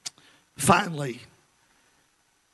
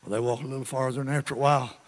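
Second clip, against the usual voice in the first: usual speaking voice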